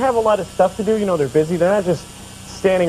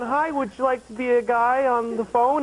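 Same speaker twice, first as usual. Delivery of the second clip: telemarketer; 6 semitones higher, 4 dB quieter than usual. Retold or imitated. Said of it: "It's like calling me and going".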